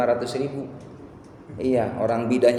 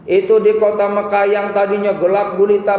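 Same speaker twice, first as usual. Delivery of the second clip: high, loud sermon storytelling; urgent, raised pulpit voice; impassioned preaching; 8 semitones higher, 7 dB louder than usual. Real, not acted. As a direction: preach the climax at full force, pitched up. Preaches at full force.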